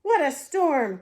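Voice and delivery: loud, high mole exclaiming about the storm; hammed-up mole, loud not muttered; mole muttering. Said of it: "Mole mutters".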